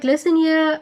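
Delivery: mock stern call. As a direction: bark it like a bossy scold. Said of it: "she's like".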